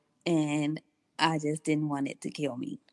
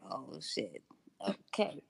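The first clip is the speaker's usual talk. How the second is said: hushed dread; 10 dB quieter than usual. Retold or imitated. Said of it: "And I was like".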